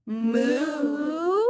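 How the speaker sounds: long, drawn-out moo; cow moo. Shown as a demonstration. Teacher leading children in animal sounds, long moo. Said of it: "Let's try all together".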